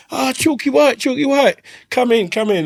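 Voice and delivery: high, loud, delighted-sounding greeting; excited welcome. Retold or imitated. Club host calling a remembered friend inside, excited. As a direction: hail an old acquaintance, imitating his excited call. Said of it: "he's come running back up, he's going".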